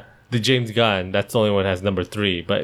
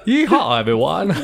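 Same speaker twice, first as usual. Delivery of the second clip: high hammed-up cowboy accent; a playful cowboy accent holler; fake cowboy accent; 5 semitones higher, slower than usual. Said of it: "That's cowboy".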